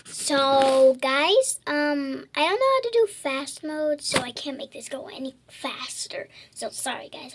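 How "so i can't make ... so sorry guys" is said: a soft unvoiced whisper to viewers; whispered aside. Real, not acted. Child apologizing to viewers in a whisper. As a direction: drop to a whisper as if sharing a secret.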